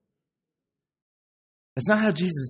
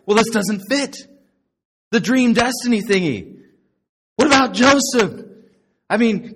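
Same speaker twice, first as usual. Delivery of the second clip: louder, higher, worked-up protest; mock distress; 9 semitones higher, 7 dB louder than usual. Preacher rocked by Joseph missing out, mock-distressed protest. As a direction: protest loudly as if your whole theology is collapsing.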